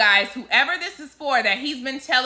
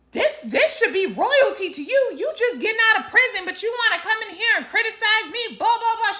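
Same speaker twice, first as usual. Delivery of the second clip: mock outrage, pitched higher, retold; cursing him out; 8 semitones higher than usual. Girlfriend telling off the man fresh from prison, mock indignant and higher. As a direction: imitate her going off on him, a bit hammed up. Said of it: "This girl went to cursing him out".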